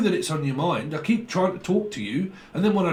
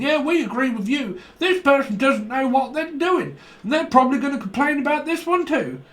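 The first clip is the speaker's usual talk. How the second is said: mocking the bandwagon crowd; 11 semitones higher, 4 dB louder than usual. Mocking people jumping on bandwagon.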